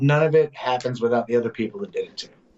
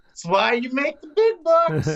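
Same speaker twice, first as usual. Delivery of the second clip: impressed; 12 semitones higher, slower than usual. Retold or imitated. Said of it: "you're just like".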